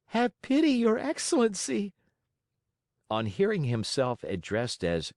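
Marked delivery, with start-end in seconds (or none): 0.1-1.9 much higher, begging puppet voice; pleading Pinocchio. A narrator's reading. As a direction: beg like a frightened little boy.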